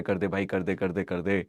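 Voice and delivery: ordinary delivery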